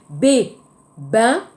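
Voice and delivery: bain with a crisp voiced B; clear B. Shown as a demonstration. Teacher contrasting B and P for Koreans, crisp. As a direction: stress the B of bain, lips closed. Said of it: "Attention aussi, le B".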